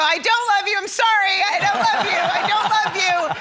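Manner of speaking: high, loud flustered retraction; panicked take-back. Retold or imitated. Mom taking back I love you to daughter's friend, high and loud. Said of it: "and then I go".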